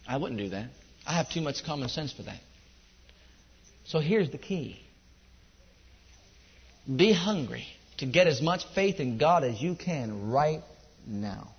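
low, soft, earnest preacher; subdued, intimate pulpit teaching; hushed exhortation. Real, not acted. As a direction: bring it down and urge them quietly, with weighty pauses. Quiet earnest exhortation to congregation.

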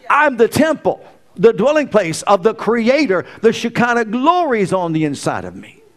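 high, loud fervent preaching; preaching at full force. Real, not acted. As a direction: build the fire, call it out.

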